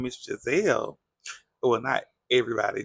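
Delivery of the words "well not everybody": ordinary talk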